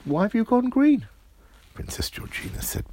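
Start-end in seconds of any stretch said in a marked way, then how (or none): 0.0-1.1 high, loud astonished question; amazed friend. Acted. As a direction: squeak it out in wonder.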